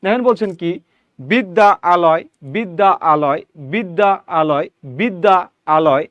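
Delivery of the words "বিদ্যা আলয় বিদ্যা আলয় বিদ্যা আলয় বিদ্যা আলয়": বিদ্যা আলয় said slowly as two separate words. Shown as a demonstration. Teacher to class, slowly splitting বিদ্যা আলয়. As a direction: pause between বিদ্যা and আলয়, unhurried. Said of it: "এই বিদ্যা আলয় বলতে বলতে যখন আপনি দ্রুত উচ্চারণ করবেন".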